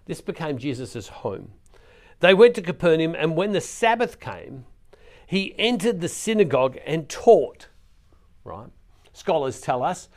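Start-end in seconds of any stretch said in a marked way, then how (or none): 2.2-7.7 louder, punching went; forceful reread. Acted. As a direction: proclaim the verse with full force. Re-reading the verse with more force.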